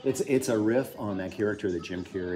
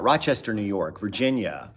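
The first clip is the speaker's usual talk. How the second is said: ordinary talk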